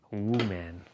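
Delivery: "Woo man" said as two words, a long stretched "woo" then "man"; woo man pun. Shown as a demonstration. Showing off the woman pun, stretching "Woo man". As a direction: lean into "Woo" so the joke on woman lands. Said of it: "That's a play on words for woman".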